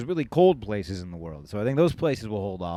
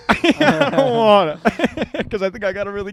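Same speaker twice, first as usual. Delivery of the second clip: laughing; 10 semitones higher, 10 dB louder than usual. Real, not acted. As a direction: say it through real laughter. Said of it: "Give me your best Ethiopian accent".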